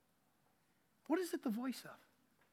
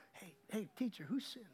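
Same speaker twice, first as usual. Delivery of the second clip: low-volume, behind-his-back murmur; hushed aside; 4 semitones lower, 14 dB quieter than usual. Retold or imitated. Church person asking behind the blind man's back, hushed. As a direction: ask it under your breath, not to him. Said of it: "not to him".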